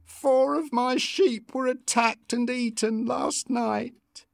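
high, slow, tearful lament; weeping as she tells of the loss; crying. A narrator's reading. Farm woman telling Lucy about the attack, tearful. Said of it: "cried the woman".

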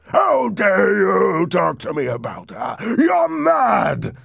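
slow, loud, high roar of outrage; frightening outburst. A narrator's reading. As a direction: roar it furiously, climbing to a scream. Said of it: "Heathcliff's reaction was frightening".

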